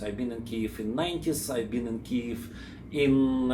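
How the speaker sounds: ordinary talk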